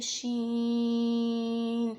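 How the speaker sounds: شین with the ee held long; long madd on sheen. Shown as a demonstration. Teacher demonstrating the long شین to students. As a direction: draw out شین slowly. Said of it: "مد آ رہی ہے".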